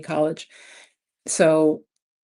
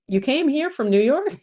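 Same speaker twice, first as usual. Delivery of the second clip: high, loud astonished question; incredulous stranger; 8 semitones higher, 5 dB louder than usual. Retold or imitated. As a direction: mimic a shopper who can't believe it. Mimics amazed supermarket stranger.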